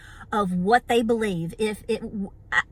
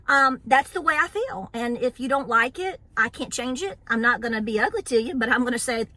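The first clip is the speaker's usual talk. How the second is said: ordinary delivery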